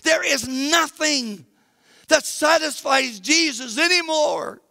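high, forceful pulpit delivery; impassioned preaching. Real, not acted. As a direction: drive the point home to the congregation.

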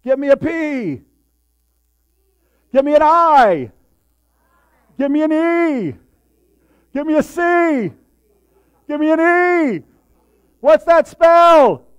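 high, loud cheerleader chant; cheerleader yell. Retold or imitated. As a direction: shout each letter like a pep rally. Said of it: "So I yell out".

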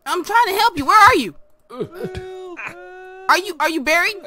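high, loud call to someone lost. Real, not acted. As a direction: shout back to someone crying for help. Calls to someone screaming for help.

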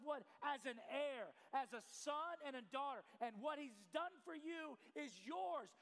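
impassioned, projected sermon delivery; preaching. Real, not acted. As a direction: drive the point home to the congregation. Preaches at full force.

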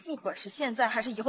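usual speaking voice

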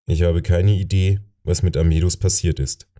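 usual speaking voice

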